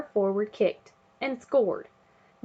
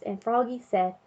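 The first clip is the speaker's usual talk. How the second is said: plain reading voice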